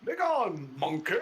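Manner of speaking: hammy, commanding shout; in-character command. Acted. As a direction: proclaim it to banish a foe. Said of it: "he says".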